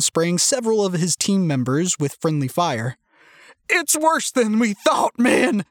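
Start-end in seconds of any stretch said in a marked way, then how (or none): 3.7-5.6 high, drawn-out panicky call; shouted alarm. A narrator's reading. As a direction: cry out the alarm to the team. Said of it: "Owen shouted to his swat team".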